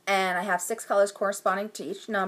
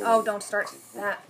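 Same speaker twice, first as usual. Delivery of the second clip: ordinary talk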